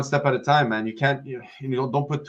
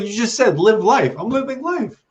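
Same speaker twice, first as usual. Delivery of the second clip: exasperated outburst; 9 semitones higher, 6 dB louder than usual. Retold or imitated. To friends telling him to live life, a heated indignant outburst. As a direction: throw their own words back at them.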